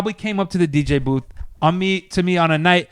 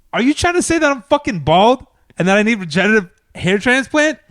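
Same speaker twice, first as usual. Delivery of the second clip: higher-pitched, offended disbelief; indignant retort; 5 semitones higher, 4 dB louder than usual. Retold or imitated. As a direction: throw the question back, affronted. Acted indignant retort to woman.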